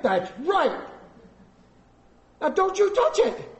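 high-pitched exasperated warning; stern parent warning. Acted. Dad warning child off his Coke, exasperated.

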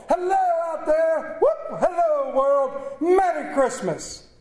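a man overwhelmed with happiness; giddy joy. Acted. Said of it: "laughing and crying in the same breath".

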